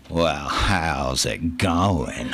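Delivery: very slow, low Texan drawl. Retold or imitated. Texan friend asks how quitting is going, drawn-out Texan drawl. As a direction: imitate a Texan, stretching the vowels right out. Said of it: "he's got that Texan drawl".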